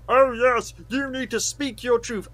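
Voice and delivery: high, lofty mock-preachy voice; mock arrogant. Host imitating film studios preaching, mock arrogant. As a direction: mimic a self-satisfied lecture from on high. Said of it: "arrogantly say".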